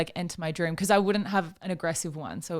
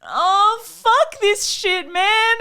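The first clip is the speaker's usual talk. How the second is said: high, loud, very slow imitated grumble; mock grumpy; 12 semitones higher, 8 dB louder, much slower than usual. Imitating the boyfriend getting up, drawn-out mock grumble. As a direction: drag it out like a cranky half-asleep man. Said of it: "And you were like".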